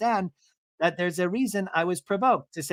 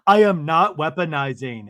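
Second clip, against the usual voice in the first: loud, indignant mock protest; emphatic denial; 8 dB louder than usual. Recapper as Lisa denying the charge, loud indignation. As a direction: push back loudly, acting offended. Acted quarrel, Lisa's denial.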